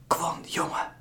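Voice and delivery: tender hushed whisper; a mother whispering to her son's friend. Acted. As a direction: call him gently without a sound. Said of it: "hoort hij zijn moeder fluisteren".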